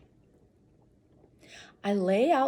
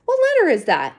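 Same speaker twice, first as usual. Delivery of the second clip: loud eager question; 9 semitones higher, 10 dB louder than usual. Real, not acted. Child calling out to the reader, loud and eager. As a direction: call it out high and loud, curious.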